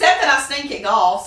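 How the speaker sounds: self-mocking laugh. Real, not acted. Jokes about her golf.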